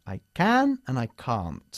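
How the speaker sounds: "can" short and "can't" with a sharp final t, slowly; can versus can't. Shown as a demonstration. Teacher demonstrating can and can't slowly. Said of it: "siempre recomiendo que digas can't".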